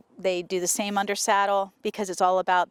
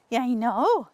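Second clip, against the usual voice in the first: ordinary talk, nothing marked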